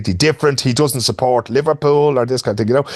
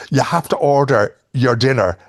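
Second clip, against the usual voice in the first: normal talking voice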